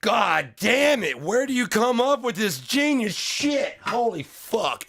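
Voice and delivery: high, loud, slow gushing boss voice; mock boss praise. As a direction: gush over the idea like a delighted boss.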